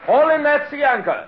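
order. Acted. Giving order on deck.

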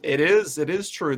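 ordinary talk

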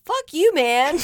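loud, high shout from a car; hammed-up outburst; pretend road rage. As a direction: yell it at another driver, for fun. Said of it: "I wish I was in the car, be like".

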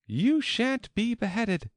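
very high, firm promise; high-pitched, emphatic Alice; protective Alice. A narrator's reading. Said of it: "said Alice".

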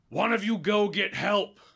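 loud, high-pitched goblin order; main goblin. Acted. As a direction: shout it as the goblin leader calling for help. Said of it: "the main goblin goes".

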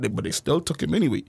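ordinary talk

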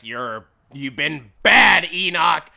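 loud, drawn-out mock telling-off; pissed-off scolding. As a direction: scold him loudly, playing an angry boss. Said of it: "he's already pissed".

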